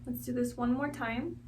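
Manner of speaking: soft, unhurried meditation guide voice; guided meditation. Real, not acted. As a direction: guide them gently through each breath, quietly. Leads a guided breathing meditation.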